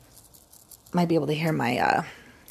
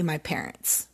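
ordinary talk

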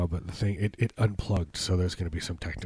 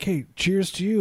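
showboating bro voice; 10 semitones higher than usual. Retold or imitated. Imitating showboating god.

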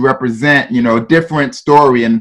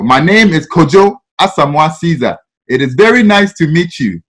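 put-on childhood Ghanaian accent, every syllable sounded; thick African accent. New kid from Ghana introducing himself, thick Ghanaian accent. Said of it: "I have a thick accent".

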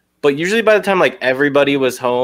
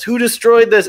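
disgusted yell; 10 semitones higher, 5 dB louder than usual. Retold or imitated. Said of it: "And he goes".

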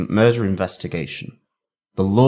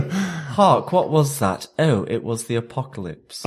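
theatrical British stage voice, higher pitch; hammy stage actor; 4 semitones higher than usual. Acted. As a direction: deliver it like an old-fashioned stage actor. Continues the theatrical hark bit.

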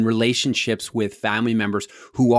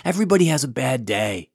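high, gentle imitation of a big guy calming someone; lightly voiced reassurance; mock soothing; 8 semitones higher, 3 dB louder than usual. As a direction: play the calmed-down trucker talking him down. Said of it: "this huge guy is trying to calm him down".

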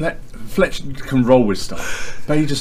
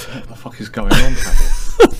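cracking up mid-question; laughing disbelief; 9 dB louder, slower than usual. Retold or imitated. Players laughing in disbelief at Pavel, loud laughing outburst. Party shocked at Pavel's antics.

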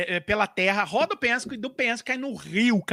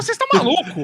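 higher, louder incredulous protest; mock outrage; 7 semitones higher, 5 dB louder, faster than usual. Podcaster voicing officials who read the script, mock outrage. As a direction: burst out, scandalised by the request.

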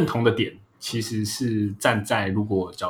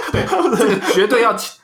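laughing; 11 semitones higher, 5 dB louder than usual. Real, not acted. Laughs at teasing remark.

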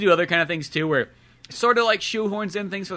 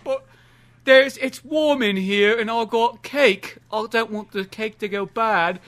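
slow, higher-pitched, dopey put-on voice; reluctant Watson; 4 semitones higher, slower than usual. Sidekick clinging to his cake, slow reluctant protest. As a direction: drag out a feeble excuse. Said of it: "He's like".

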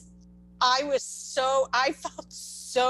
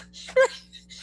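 high laugh; 13 semitones higher than usual. Real, not acted. Laughs after her joke.

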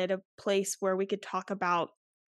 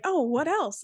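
high imitation of a little boy's country accent on "Oh, what else?"; 8 semitones higher, slower than usual. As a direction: quote the little boy's country twang, pitched way up. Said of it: "we say it in that little boy's accent".

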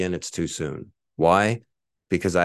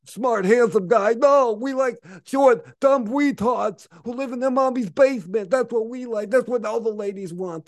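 much higher, louder sneering caricature of the haters; mock hater voice; 14 semitones higher, 4 dB louder than usual. Mocking what the haters think.

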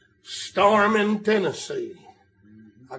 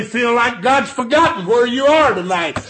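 full-force preaching. Real, not acted. Preacher urging the congregation through life's storms, full-force preaching. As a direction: build to a shouted peak, then ease off.

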